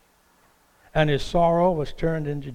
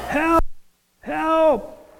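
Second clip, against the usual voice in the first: high, desperate call for help; a man clinging to a bush yelling for help; crying out for help; 12 semitones higher than usual. Retold or imitated. Man hanging from a bush calls out, desperate and high. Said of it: "he cries out for help".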